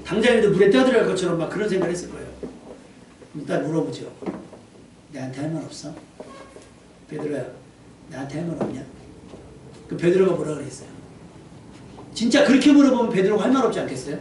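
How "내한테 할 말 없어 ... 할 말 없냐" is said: low, soft, restrained question; quiet questioning. Acted. Jesus asking Peter for a word, quiet questioning. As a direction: ask gently, with space between phrases.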